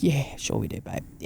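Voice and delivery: a mock henpecked husband agreeing with his wife; weary husband. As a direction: go along with her, half-hearted. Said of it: "And he's a bit like".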